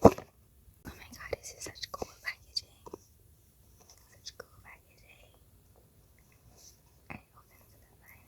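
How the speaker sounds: very soft, voiceless whisper. Real, not acted. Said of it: "Why am I whispering?".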